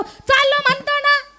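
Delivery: high, drawn-out angry insistence; hammed-up exasperated old man; worked up. Old man insists to wife he is leaving, worked up. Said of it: "म्हातारं जास्तच आवेशात आलं".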